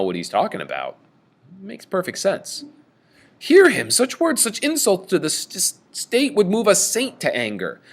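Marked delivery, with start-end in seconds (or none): 3.4-7.8 much higher, loud indignant cry; outraged king shouting; great anger. Retold or imitated. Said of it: "it's definitely moving Oedipus to great anger".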